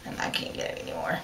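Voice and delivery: breathy whisper, mock sad; whispered aside. Real, not acted. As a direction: drop to a whisper. Sad aside about lost fabric.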